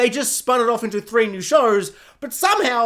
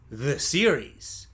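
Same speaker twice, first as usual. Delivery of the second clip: low, slow subtitle announcement; mock title voice; 7 semitones lower, 5 dB quieter, slower than usual. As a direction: intone it low, softer and drawn out.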